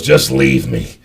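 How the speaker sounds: very low, sunken mumbled quote; dropped-to-the-floor low voice; resigned drunk friend. Retold or imitated. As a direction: imitate a big man giving up, deep down low. Fallen drunk friend begging to be left.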